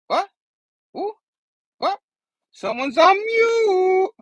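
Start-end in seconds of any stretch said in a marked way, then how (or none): 2.5-4.1 slow teasing. Acted. Teasing drawn-out mute remark.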